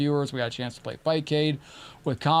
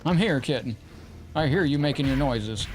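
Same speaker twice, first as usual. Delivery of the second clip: ordinary talk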